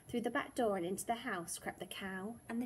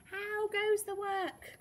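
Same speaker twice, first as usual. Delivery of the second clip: high, drawn-out farmer's call; 10 semitones higher, slower than usual. A narrator's reading. Farmer calling to the working duck, high drawn-out call. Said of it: "called the farmer".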